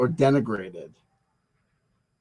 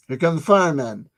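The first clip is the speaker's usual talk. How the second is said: normal talking voice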